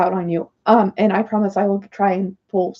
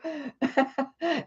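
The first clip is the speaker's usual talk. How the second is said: laugh; 6 semitones higher, 7 dB quieter than usual. Real, not acted. Laughs at her own bit.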